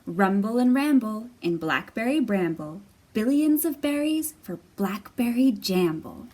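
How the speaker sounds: lively sing-song read-aloud; reading to children. Acted. Teacher reading Jamberry aloud to young kids. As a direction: bounce the rhymes for little listeners. Reads a picture book aloud.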